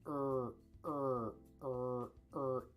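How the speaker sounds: repeated throaty "er" from the back; deep throaty "er" drill; deep er sound. Shown as a demonstration. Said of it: "when you make the er sound".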